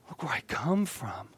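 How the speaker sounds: hushed whisper to yourself; calm self-talk. Pretend. Minister whispering calm self-reassurance to the congregation. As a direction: breathe it out softly, almost no voice. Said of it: "just calm down and say".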